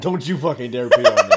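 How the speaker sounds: laughing. Real, not acted. Laughing warning.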